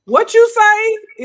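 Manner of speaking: high, slow, loud confrontational "what you say"; sassy loud challenge. Retold or imitated. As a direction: fire it back like you're ready to fight. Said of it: "is usually my question".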